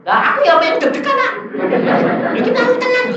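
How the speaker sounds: high, loud, half-laughing complaint; replaying a flustered outburst; agitated protest. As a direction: protest loudly at a high pitch. Replays nervous argument with God.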